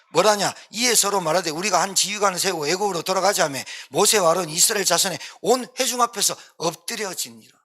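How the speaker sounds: ordinary talk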